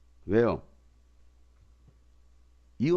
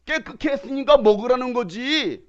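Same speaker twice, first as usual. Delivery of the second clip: loud, higher stern rebuke; exasperated scolding; scolding God; 7 semitones higher, 6 dB louder than usual. Acted. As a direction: scold the stubborn one. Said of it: "라고 하나님 말씀하시는 거예요".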